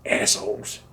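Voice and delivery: normal talking voice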